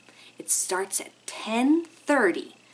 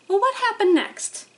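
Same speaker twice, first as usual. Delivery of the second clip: high, bright little bird voice; hummingbird voice; 11 semitones higher, 4 dB louder than usual. Acted. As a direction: pitch it way up like a tiny bird. Hummingbird character speaks.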